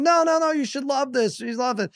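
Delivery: mock desperate insisting; insistent dad. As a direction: beg them to love it. Dad insisting kids love it.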